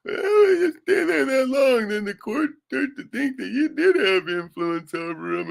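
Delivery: high, slow, whiny mock of a paralegal. Quoting the paralegal to his viewers, high slow mockery. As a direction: drag it out in a high sneering imitation. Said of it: "one of these dickhead fucking paralegals said".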